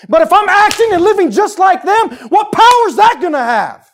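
loud, high, driving sermon climax; preaching at full force. Acted. Preacher stirring up the congregation, loud and fervent. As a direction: build it up and hammer it home to the church.

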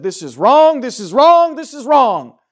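judge's emphatic rebuke, hammering each repeat; thundering denunciation. Acted. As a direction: denounce it harder with each repeat. Judge denouncing, acted by preacher.

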